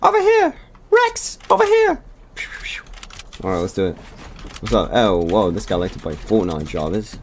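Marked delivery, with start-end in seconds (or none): 0.0-2.0 very high, loud calling out; squeaky call. Pretend. Gamer calling a teammate, squeaky high shout.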